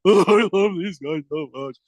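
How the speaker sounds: slow, lightly mocking sob-talk; a hint of a weepy cowardly lion. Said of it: "like the cowardly lion".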